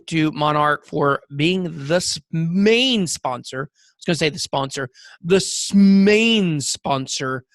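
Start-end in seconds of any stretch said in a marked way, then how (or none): 2.3-3.7 "main" held long for emphasis, then "sponsor"; drawn-out MAIN. Real, not acted. Podcast host thanking the backer, "main" heavily drawn out. 5.7-7.4 loud, very long stretch on "main"; drawn-out MAIN. Real, not acted. Podcast host repeating the shout-out, "main" loud and drawn out.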